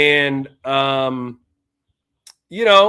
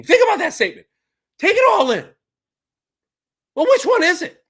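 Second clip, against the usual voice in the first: incredulous mockery; 13 semitones higher, much faster than usual. Real, not acted. Ridiculing commenter's logic.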